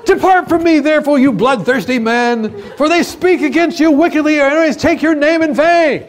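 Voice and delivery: high, sustained declamatory shout; psalmist's angry outcry. Acted. Psalmist banishes bloodthirsty men, acted furious shout. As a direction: thunder it at the enemies, high and loud. Acts out psalmist cursing his enemies.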